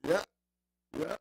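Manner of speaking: normal talking voice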